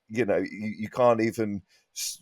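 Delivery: ordinary talk, nothing marked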